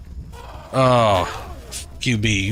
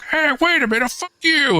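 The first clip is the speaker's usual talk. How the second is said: mock monster voice; 4 dB louder than usual. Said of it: "was like".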